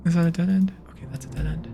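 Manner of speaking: hushed nervous whisper; whisper, scared of the dark. Real, not acted.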